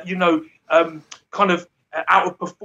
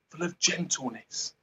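normal talking voice

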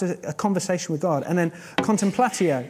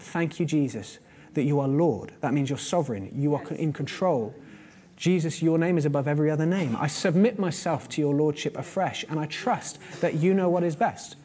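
soft, lower, intimate prayer voice; quiet prayer; 3 semitones lower, 4 dB quieter than usual. Shown as a demonstration. Pastor praying over the verse before the congregation, hushed. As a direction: pray it quietly to Jesus. Prays aloud as demonstration.

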